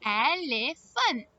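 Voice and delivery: "Elephant" slow, in distinct parts; really clear. Shown as a demonstration. Teacher repeats for children, really clear slow "Elephant". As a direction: enunciate "Elephant" slowly for learners. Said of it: "let's say it really clearly".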